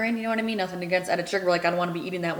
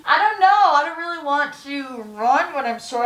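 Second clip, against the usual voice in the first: whiny; 6 semitones higher, 3 dB louder than usual. Real, not acted. Whining about sore legs.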